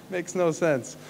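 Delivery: soft, slow, amused aside with a laugh. Real, not acted. Laughing off the classmate's claim, soft and slow. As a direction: chuckle it out quietly, shaking your head.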